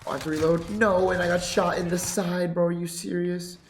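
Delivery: real annoyance bursting out mid-game; frustrated outburst. Real, not acted. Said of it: "I'm pissed".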